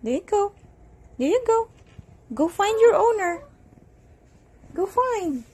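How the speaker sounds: high sing-song coaxing; high-pitched pet talk; sending off a pet. Real, not acted. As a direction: coax a cat along up high. Sending the cat to its owner.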